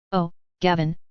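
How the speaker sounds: usual speaking voice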